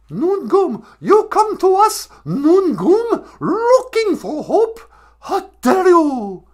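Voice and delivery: loud, high, drawn-out mock outrage; hammed-up wailing accusation, very high; Greta Thunberg. Activist uses her new word to scold, mock high tirade. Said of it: "Greta Thunberg".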